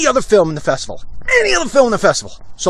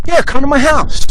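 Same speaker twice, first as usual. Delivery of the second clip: easygoing high invite; 11 semitones higher, 6 dB louder, slower than usual. Retold or imitated. Ed Burns voiced inviting.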